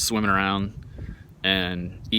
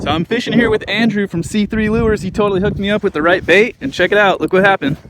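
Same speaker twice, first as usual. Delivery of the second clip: loud, high, thrilled announcement; excited shout; 12 semitones higher, 10 dB louder than usual. Real, not acted. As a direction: announce the catch at full volume. Excited catch announcement to camera.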